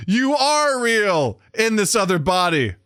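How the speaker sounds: high, drawn-out swooning voice; mock romantic declaration. Voices Demi Moore's romantic declaration.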